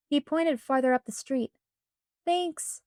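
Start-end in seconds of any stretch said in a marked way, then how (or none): none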